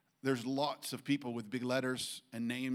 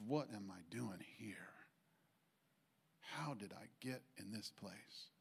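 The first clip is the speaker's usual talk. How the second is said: near-whisper, much softer, with long pauses; hushed self-questioning; 3 semitones lower, 11 dB quieter than usual. Retold or imitated. Friend at a bar at three, hopeless, asking himself in a hush. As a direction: retell it as a man quietly asking himself.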